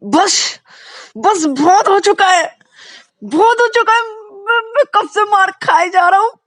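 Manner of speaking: very high, loud outburst; furious shout. Acted. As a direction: explode at the onlookers. Vikas explodes at everyone. (ज़ोर से चिल्लाते हुए गुस्सा)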